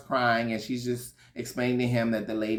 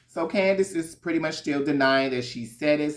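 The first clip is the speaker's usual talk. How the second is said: ordinary delivery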